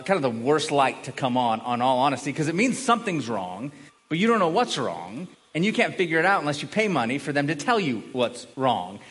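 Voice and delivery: normal talking voice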